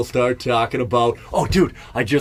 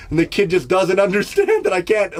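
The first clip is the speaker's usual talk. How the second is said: ordinary talk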